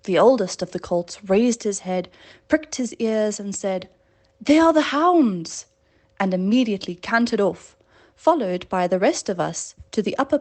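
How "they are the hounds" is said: excited call. Acted. Colt calls out the hounds.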